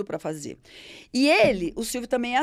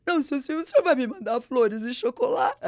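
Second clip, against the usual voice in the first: mock whining. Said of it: "começa a chorar".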